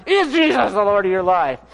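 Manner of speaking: strained mock-furious demand; acting out pent-up frustration; exasperated outburst. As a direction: demand it as if grabbing someone's shirt. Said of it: "That's how I felt inside".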